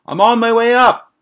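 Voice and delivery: very high, loud call up to a window; calling up to a friend at the window; shouted up from the street. Retold or imitated. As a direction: yell it up from the sidewalk. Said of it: "he says".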